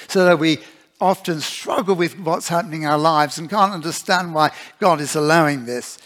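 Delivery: full-voiced sermon delivery; preacher urging the church to trust God, preaching. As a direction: preach it out to the congregation. Preaches to the congregation.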